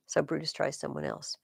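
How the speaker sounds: normal talking voice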